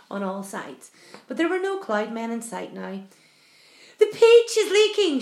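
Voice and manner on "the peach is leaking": high, loud cry of alarm; alarmed shout. A narrator's reading. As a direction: shout out the bad news. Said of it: "shouted the old green grasshopper".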